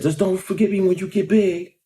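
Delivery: imitating the owner's parting request; pizza man voice. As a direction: pitch up into the owner asking a favor. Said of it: "And he said".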